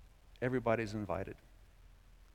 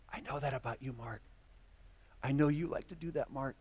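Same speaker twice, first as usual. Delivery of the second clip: softer, tender knowing voice; Jesus gently telling Mark he knows, softer; gentle Jesus; 6 dB quieter than usual. Acted. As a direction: speak kindly to a friend's fault. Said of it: "what's Jesus' response to me".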